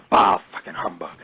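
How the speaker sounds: low, hushed Scrooge-like grumble; whispered bah humbug under the breath; grumpy whisper. Retold or imitated. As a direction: mutter it like a sour old miser. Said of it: "it's more like".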